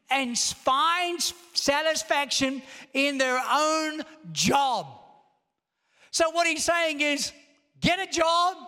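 high-pitched, emphatic sermon delivery; impassioned preaching. Real, not acted. Preacher driving home Solomon's conclusion to the congregation, impassioned. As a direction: preach it with rising urgency, stretching key phrases.

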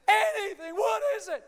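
loud, high mock wail; frantic hammed-up shout; Rocky. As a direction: cry it out desperately. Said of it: "He's crying".

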